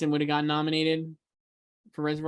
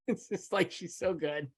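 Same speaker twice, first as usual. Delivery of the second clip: breathless laugh spilling into the words; 9 dB quieter, slower than usual. Real, not acted. Podcaster laughing as she praises the actress's performance. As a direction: gush while still laughing.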